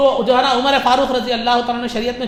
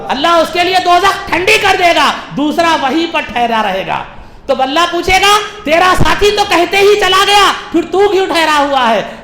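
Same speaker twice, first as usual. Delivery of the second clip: raised, ringing narration and questioning; full-force preaching; 8 semitones higher, 7 dB louder than usual. Acted. Preacher tells of Allah questioning the one who stayed, full-force preaching. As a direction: proclaim it high and loud.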